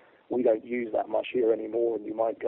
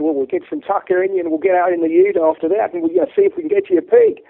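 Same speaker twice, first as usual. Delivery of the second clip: loud, high put-on Aussie voice; imitated Aussie mate making big plans; Australian accent; 13 semitones higher, 9 dB louder, faster than usual. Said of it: "I hope that's not too bad an accent".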